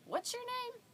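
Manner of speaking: very high imitation of a puzzled child; confused three-year-old. Confused three-year-old asking the woman back, high child voice. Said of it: "he looks confused".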